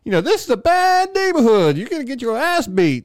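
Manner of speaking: high cartoonish tough-guy warning; mock warning. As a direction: squeak out the warning hammily. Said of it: "he's like".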